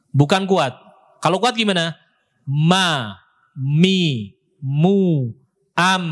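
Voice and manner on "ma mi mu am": MA, MI, MU, AM with lips pressed firmly on each m; strong lip press. Shown as a demonstration. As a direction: press hard into every m of "Ma, mi, mu, am". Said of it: "Ini kuat".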